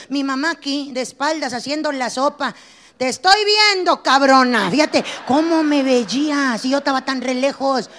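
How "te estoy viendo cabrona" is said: mock mom's loud warning; mother calling out. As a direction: bark it across the room like mom. Mother scolding from afar.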